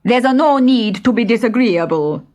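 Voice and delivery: lightly voiced stuffy bandit; middle-sized bandit. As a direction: chide him primly. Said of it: "said the middle-sized man".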